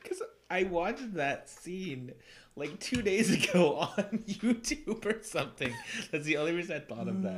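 high, giggly explanation; laughing. Real, not acted. Explaining the joke's source to a friend, giggly. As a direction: still giggling while explaining.

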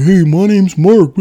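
slow, high, loud caricature voice; hammed-up buddy impression. Buddies doing an impression of Mark, hammed-up caricature. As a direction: belt out a goofy high-pitched mock introduction. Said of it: "my buddies would do an impression".

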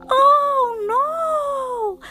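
very high, loud wail; wailing ghost. A narrator's reading. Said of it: "wailed Ghost".

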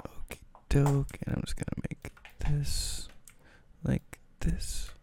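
soft, half-whispered busy murmur; absent-minded whispery self-talk; muttering to oneself. Real, not acted. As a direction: talk under your breath while fiddling. Talks to himself while adjusting the screen.

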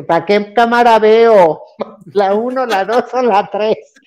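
high, drawn-out diva question with laughter; self-mocking star. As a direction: ham it up like a star finding the camera. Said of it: "Yo ya estoy en artista". (artista engreído, en tono agudo y burlón)

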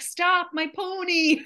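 put-on high, slow, drawn-out plea as a little girl; stretched-out, high-pitched cry of a small child; childish wail. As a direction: wail it like a distressed young girl. Child pleading as ponies are chased.

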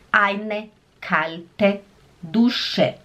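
very slow, each word drawn out; bem devagar. Shown as a demonstration. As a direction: drag it out for repetition. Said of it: "Also, wie". (bem devagar, palavra por palavra)

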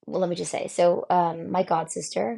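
usual speaking voice

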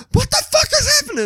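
very high, loud imitated shriek; freaked-out swearing. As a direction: shriek it like a panicking girlfriend. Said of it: "And started swearing and everything".

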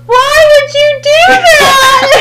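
high, slow, sobbing wail; child crying out why; crying shout. Retold or imitated. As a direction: wail it through tears like a hurt child. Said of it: "And Emily goes".

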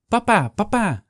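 put-on a little boy shouting to his father; child calling papa. A narrator's reading. Johannes waving from the boat. (hoog opgewonden kinderstem die roept)

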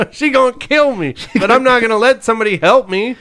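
high, loud panicky voice; hammed-up scared of mom; mock panicky guy. Voicing the dog owner.

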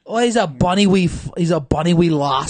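loud mock Scottish accent; hammed-up comic Scottish. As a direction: put on a broad Scottish burr. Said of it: "can you do a Scottish".

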